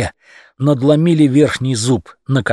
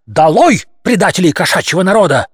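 loud, higher call to the mob; rallying cry; 6 semitones higher, 4 dB louder than usual. A narrator's reading. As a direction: rouse the crowd against traitors. Rabble-rousing speech climax.

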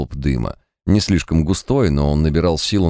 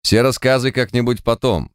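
strict, higher-pitched command; stern; 7 semitones higher than usual. A narrator's reading. Leader putting off the clown's story, stern. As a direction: cut off the storytelling firmly. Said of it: "строго заявил Март".

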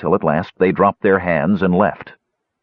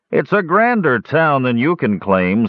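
higher, sneering Darrow taunt; 7 semitones higher than usual. Acted. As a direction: look down on him as you say it. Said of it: "sneered one".

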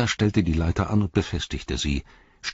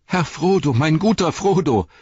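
higher, louder, crying out his master's name; tearful call; 9 semitones higher, 5 dB louder than usual. A narrator's reading. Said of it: "rief Sam, fast blind vor Tränen".

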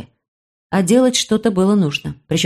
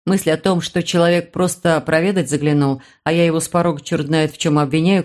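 ordinary delivery

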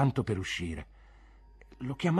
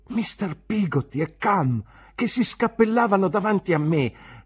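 usual speaking voice

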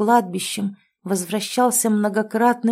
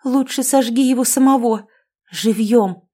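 higher, raised vindictive voice; narrated vindictive woman; vengeful demand; 4 semitones higher, 4 dB louder than usual. A narrator's reading.

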